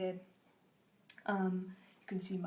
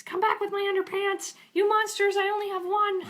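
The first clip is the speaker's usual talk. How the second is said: very high, loud cartoon shouting; high-pitched mock-furious holler; indignant yelling; 12 semitones higher, 10 dB louder than usual. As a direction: yell after fleeing thieves in comic outrage. Dade yells after his siblings.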